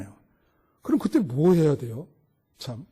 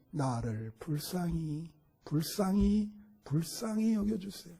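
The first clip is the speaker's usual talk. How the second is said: pleading prayer; 4 dB quieter than usual. Acted. Preacher praying aloud, pleading.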